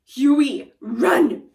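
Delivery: loud urgent yell; older brother yells at Huey to run; shouting run. Acted. Urging brother to flee.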